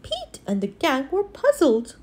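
plain reading voice

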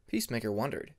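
usual speaking voice

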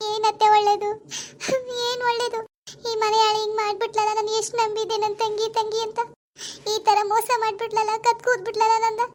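high, plaintive tearful lament; wailing complaint to mother-in-law; crying. Acted. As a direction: pour out the betrayal through tears. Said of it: "ಹಿಂಗ್ ಅಳ್ತಾ ಕೂತಿದ್ಯಲ್ಲ".

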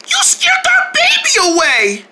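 high-pitched scolding cry; hammed-up upset wail; mock outrage. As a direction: shriek it in play indignation. Scolding over the lost baby.

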